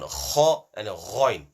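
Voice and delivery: slow, thick KHA and GHAIN. Shown as a demonstration. Teacher showing pupils how letters come out, thick tone. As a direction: bring KHA and GHAIN out slowly, full and thick. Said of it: "with a thick tone".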